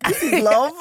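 the sister in amused shock; laughing disbelief. Retold or imitated.

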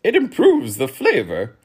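high, loud duke character; duke's voice. A narrator's reading. Duke recommending a seasoning, high and loud. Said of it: "suggested the duke".

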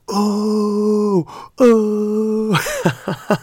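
high, drawn-out gasping "oh"; crowd's mock gasp. Audience reacting to Shia's comeback, high mock gasp. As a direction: ooh the way the whole room did. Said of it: "We were all like".

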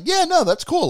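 high-pitched, breezy mock reply; high voice of an agreeable fan; acted easygoing agreement. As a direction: go way up, happily going along. Acts out viewer's agreeable reply.

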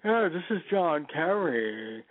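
slow, drawn-out imitation of a stiff patrician voice; mock Kerry, slowly and haughtily; slow patrician send-up of John Kerry; John Kerry impression. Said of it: "right down to the way he speaks".

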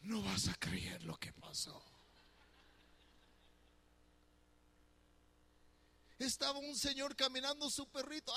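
wife spilling every detail excitedly; Fanny excited retelling. Retold or imitated. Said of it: "si le preguntas a la hermana Fanny, mi esposa".

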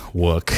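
"work" pronounced "wook", with no r; accented slip. Real, not acted. Podcaster catching their own slip, "work" echoed as an accented "wook". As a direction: say "work" to rhyme with "look".

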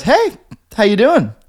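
a hint of a suspicious guard; mock security guard. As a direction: play the overly friendly guard. Security guard greets narrator.

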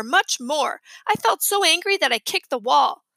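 usual speaking voice

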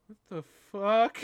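high, loud baffled what the fuck; stunned monster waking up; baffled reaction. Retold or imitated. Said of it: "Just like".

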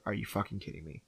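low, hushed mock exasperation; retold disbelief. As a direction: imitate a husband muttering in disbelief. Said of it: "Steve's like".

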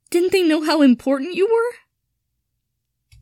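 high, loud, slow tearful cry. Acted. Draco, crying over Harry's cupboard, pleads in a high slow tearful voice. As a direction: let the tears break the whole line open. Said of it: "try and stop crying".

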